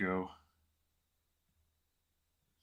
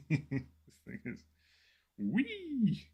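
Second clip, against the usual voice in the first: laughing, then wee squeaked very high and louder; squeaky wee; 12 semitones higher, 5 dB louder, much slower than usual. Real, not acted. Painter laughing at his tiny brush, squeaking wee.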